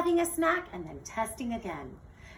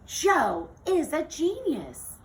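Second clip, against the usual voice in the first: slow, loud, awed; stunned she finally gets it; amazed realization; 6 semitones higher, 7 dB louder, slower than usual. Acted. Melia realizing Jo fixed her robot, slow awed exclamation.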